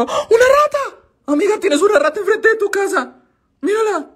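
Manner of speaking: high, shrieking surprise at a rat; startled shriek. Real, not acted. Streamer spotting a rat outside the neighbor's window, shrieking. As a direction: yelp it out, a rat!.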